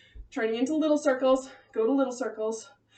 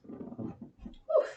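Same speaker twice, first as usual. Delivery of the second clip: voiceless, soft puff of breath; winded exhale; 5 dB quieter than usual. Real, not acted. Coach catching breath after burpees, breathy whew. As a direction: blow the air out to recover.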